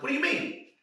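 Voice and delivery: sudden high-pitched question; surprised. Retold or imitated. Confused student asking his acting teachers. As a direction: squeak it out, caught off guard. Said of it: "I'm like".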